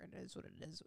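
soft, unvoiced shrug; resigned mutter. Real, not acted. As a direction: let it go quietly. Resigned aside.

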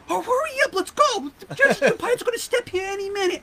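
high, pushy hurry-up voice; impatient boss hurrying. Retold or imitated. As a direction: hurry him along with a squeal. Baker hurrying the narrator.

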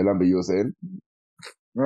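ordinary talk

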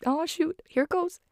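real tearful squeak, caught off guard; welling up. Real, not acted. Fan overcome by the family kite scene, high and teary. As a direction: voice jumps high with coming tears. Tearing up again over the scene.

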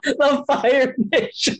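slow, high, grand movie-trailer voice; booming cartoon narrator; dramatic announcer. Quoting Avatar, dramatic.

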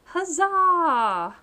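high, loud festival cheer; excited cheer. Acted. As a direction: cry huzzah like a Renaissance reveller. Mock medieval cheer.

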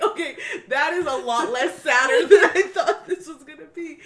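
amused, hammed-up relief; laughing relief. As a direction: crack up while retelling the reaction. Retold reaction amid laughter.

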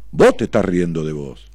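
normal talking voice

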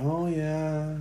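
faint, soft mock old person; lightly voiced vague old man. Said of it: "All old people are like".

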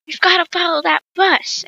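mild hurried urgency; in a hurry. Acted. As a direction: urge friends to chase the bus. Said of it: "I said in a hurry".

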